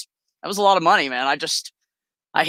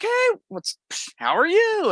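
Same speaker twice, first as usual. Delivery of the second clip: higher, caught-off-guard greeting; surprised hello; slower than usual. Retold or imitated. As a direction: fake a cheery hello while down. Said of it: "I was like".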